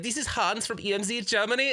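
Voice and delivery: high-pitched mock German phone greeting; hammed-up squeaky German caller; terrible German accent. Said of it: "I do a terrible German accent".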